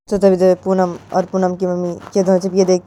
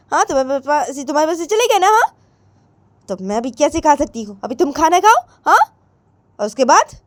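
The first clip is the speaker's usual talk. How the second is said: raised-pitch scolding and orders; bossy mother; 8 semitones higher, 5 dB louder than usual. Acted. Mother handing chores to daughter before leaving, bossy. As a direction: order her about with insistent, lifted pitch.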